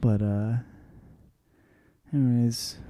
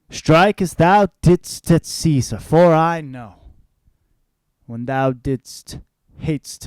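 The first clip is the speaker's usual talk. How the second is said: dramatic Shakespeare recitation; 8 dB louder than usual. Acted. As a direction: declaim it like a stage actor, big swings of volume.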